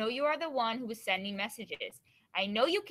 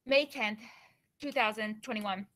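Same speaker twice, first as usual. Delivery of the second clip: normal talking voice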